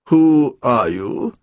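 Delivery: low, very slow demand; drawn-out, low-pitched challenge. A narrator's reading. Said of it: "he demanded at last".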